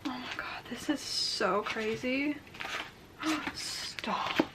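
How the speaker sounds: hushed, slow whisper of disbelief; awed whisper. Real, not acted. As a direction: breathe it out in wonder. Hushed awe over the book.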